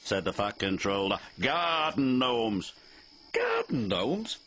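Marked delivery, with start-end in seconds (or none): 1.4-2.7 slow, deliberate correction; slow calm explanation. Acted. Fat Controller calmly correcting Percy. 3.3-4.4 high, slow puzzled question; puzzled echo. Acted. Percy puzzled by the Fat Controller's answer.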